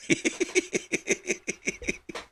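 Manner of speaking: breathy high-pitched giggle; quiet falsetto titter. Real, not acted. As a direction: let a squeaky laugh slip out. Laughing at his own show.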